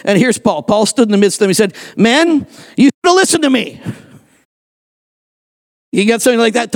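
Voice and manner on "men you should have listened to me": high-pitched reproach; acted I-told-you-so scolding. Paul addressing the men in the storm, scolding. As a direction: call out the reproach, pitched well up.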